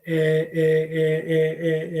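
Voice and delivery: drawn-out sung "e, e, e"; slow hummed "e" sounds, like searching for words; hesitation filler. Shown as a demonstration. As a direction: imitate someone stalling while thinking. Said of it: "the word for and in Italian, e".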